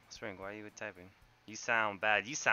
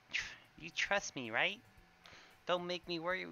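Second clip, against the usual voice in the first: higher, hammed-up earnest reading of the line; dramatic reading; 5 semitones higher, 5 dB louder than usual. Shown as a demonstration. Streamer reading a game line to chat, dramatic and heartfelt. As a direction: act it out like a worried friend pleading. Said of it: "You want a dramatic reading?".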